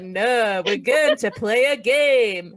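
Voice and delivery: loud, slow, exaggerated Hull accent; bad Hull accent. Workshop leader faking a bad Hull accent as teacher enters. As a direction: stretch the vowels into a badly faked Hull accent. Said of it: "put on a bad Hull accent".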